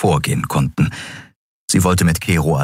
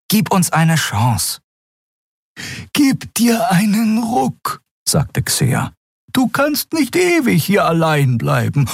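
higher, slowed voice urging him to come; pleading companions; 13 semitones higher, slower than usual. A narrator's reading. Urging Keru not to stay alone, high and slow. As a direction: lift the voice and beg him gently.

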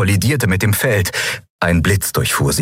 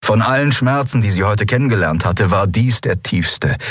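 plain reading voice

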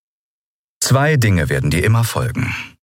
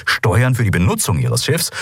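ordinary delivery, nothing marked

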